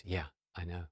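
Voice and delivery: soft, downcast agreement; hushed, resigned acknowledgement; subdued admission. Retold or imitated. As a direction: concede it very quietly. Mom concedes again.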